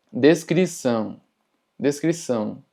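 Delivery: descrição with nasal "ão" ending; sonido nasal. Shown as a demonstration. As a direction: send the ão of descrição through the nose. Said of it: "indica un sonido nasal". (descrição con «ão» nasal)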